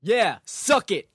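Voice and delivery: loud, slow victory yell; triumphant scream. Acted. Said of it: "I screamed".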